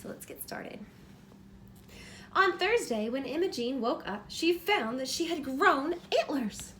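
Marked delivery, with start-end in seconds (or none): 2.3-6.7 bright, lively storybook reading; reading to children. A narrator's reading. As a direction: read it like bedtime story time for a little one. Reads picture book aloud.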